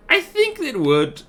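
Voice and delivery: high, slow squeaky voice; high silly voice. Pretend. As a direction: pitch way up and drag it out. Repeats line in silly voice.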